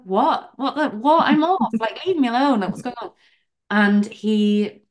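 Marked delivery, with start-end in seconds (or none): 0.0-3.1 high, rising what-the-hell confusion with a laugh; groggy outrage at being bothered; bewildered disbelief. Retold or imitated. Woken by the chairman's texts, incredulous protest.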